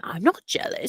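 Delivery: a hint of Priscilla protesting; imitating Priscilla. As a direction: mimic her insisting lightly. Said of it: "And Priscilla says all the time".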